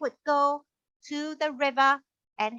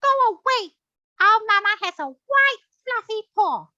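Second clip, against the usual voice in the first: much higher and louder little lamb; defiant lamb; 8 semitones higher, 7 dB louder than usual. Acted. The lamb sends the wolf away from the door, high and defiant. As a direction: shoo the wolf off like a brave small child. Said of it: "And they said".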